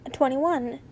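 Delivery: plain reading voice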